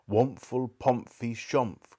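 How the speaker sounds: slow, smothered mumble; muffled from inside a hat. Acted. Uncle Andrew speaking from inside his crushed hat, muffled. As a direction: talk as if your face is buried in a hat. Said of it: "Uncle Andrew's voice from inside the hat".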